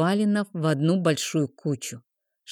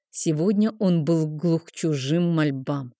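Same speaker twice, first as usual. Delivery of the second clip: plain reading voice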